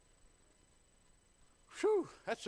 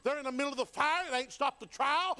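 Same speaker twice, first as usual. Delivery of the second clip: louder, with a shout on the peace of God; fervent preaching; 3 semitones higher, 3 dB louder than usual. Acted. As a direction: drive it home with mounting fire.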